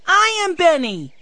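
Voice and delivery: put-on high-pitched little boy piping up; excited small boy's voice; excited cry. A narrator's reading. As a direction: cry it out high, like an eager six-year-old. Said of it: "he cried".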